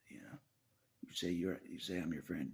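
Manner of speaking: ordinary talk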